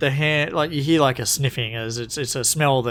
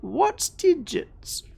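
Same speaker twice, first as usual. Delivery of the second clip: very high, slow little creature; puzzled kobold; much slower than usual. Acted. Kobold not knowing the word digits, puzzled squeak. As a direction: ask slowly in a tiny high voice.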